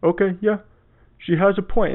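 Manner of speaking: imitating a chastened guy conceding; sheepish guy. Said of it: "And then the guy's like".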